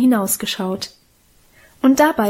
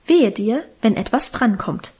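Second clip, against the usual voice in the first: usual speaking voice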